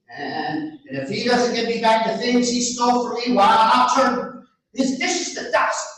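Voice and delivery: a naive lad handing over his treasure; Antonio's voice. Acted. Antonio's vow, higher and louder.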